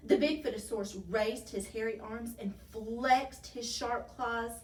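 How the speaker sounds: plain reading voice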